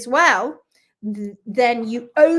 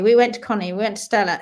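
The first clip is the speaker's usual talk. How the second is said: normal talking voice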